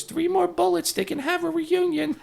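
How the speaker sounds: deadpan joke in a very high squeak. Retold or imitated. Old-timer cracks a dark Beatles joke, high-pitched impression. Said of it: "He goes".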